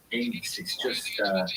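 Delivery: normal talking voice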